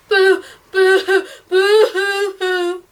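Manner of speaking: high, loud, broken boo-hoo sobs; loud childish sobbing, fading out at the end; child crying. A narrator's reading. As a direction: sob in little bursts like a child. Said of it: "The crying was coming".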